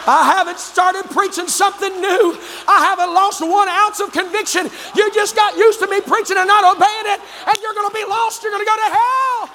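high, impassioned pulpit shouting; full-force preaching. Real, not acted. Preacher warning hearers who stopped obeying, full-force shout.

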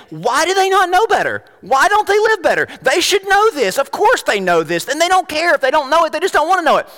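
high, exasperated complaining; impatient and judgmental. Acted. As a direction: act out a fed-up judgmental churchgoer. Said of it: "we become instantly impatient and judgmental".

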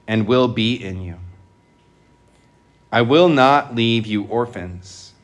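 measured, solemn liturgical reading; gospel proclamation. Real, not acted.

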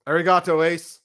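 loud, in-character salute; rehearsed fan greeting. Pretend. Fan rehearsing a meet and greet with his hero, loud playful greeting. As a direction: announce it proudly, playing the fan. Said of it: "can I play out what I plan on doing".

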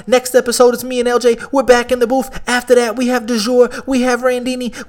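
loud, high radio-host shout-out; hype podcast outro. Acted. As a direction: pump up the listeners like a host signing off.

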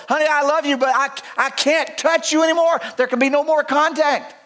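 high, tender but firm farewell; hint of a wife pushing him away gently; pained wife letting go. Wife telling her leper husband she can't touch him. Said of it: "So she had to be practical".

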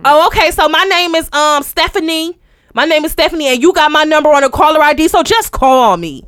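imitating a loud, high-pitched realtor; mock oblivious, booming caller; too loud caller. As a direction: give your name loudly down the phone. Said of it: "bring it on down".

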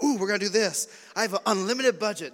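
playful over-excited bragging; mock giddy excitement. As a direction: gush like a TV home renovator. Joking retold house renovation dreams.

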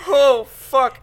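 loud, high dismayed oh fuck; cringing exclamation. Retold or imitated. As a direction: blurt it out in horror. Retold cringe watching own tape.

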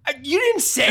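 high, loud "you didn't say"; indignant disbelief, voice shooting up; exasperated. Retold or imitated. Exasperated retort, raised voice.

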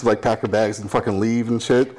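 usual speaking voice

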